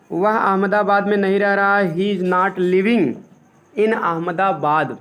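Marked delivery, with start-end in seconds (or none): 2.0-5.0 slow, each word apart; slow dictation. Shown as a demonstration. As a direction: say it slowly for note-taking. (धीरे-धीरे लिखवाना)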